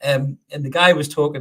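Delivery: usual speaking voice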